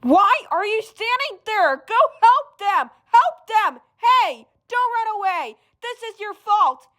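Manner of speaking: high accusing shout; angry scolding. Acted. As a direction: berate the bystander. Bystander berated after accident.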